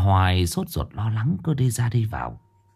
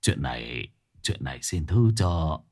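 put-on old master's voice; 5 semitones lower, 3 dB quieter than usual. Old master apologizing, low voice.

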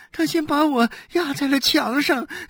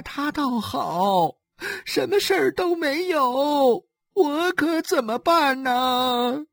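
tearful lament. Acted. Wife laments her fate on the doorstep, sobbing. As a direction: wail it out, drawing out the endings. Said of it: "哭诉着".

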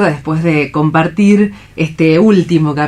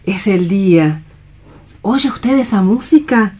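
put-on gentle young woman, light and concerned; stage-play Alicia greeting a friend; nurse-like Alicia checking on Juan; Alicia, bright and caring; 3 semitones higher than usual.

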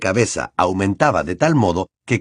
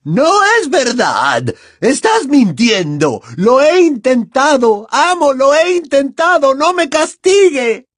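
slow, very high, loud pleading; desperate howling; 6 dB louder than usual. A narrator's reading. Bellatrix begging her master not to punish her, howling. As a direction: wail in terror to your master. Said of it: "aulló ella".

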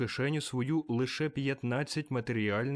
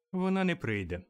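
higher voice for the mother; mother announcing; 7 semitones higher than usual. A narrator's reading. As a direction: announce it as the wife, pitch lifted. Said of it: "сповістила міс Кретчет". (мати сповіщає вищим голосом)

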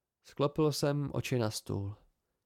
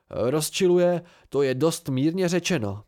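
ordinary delivery, nothing marked

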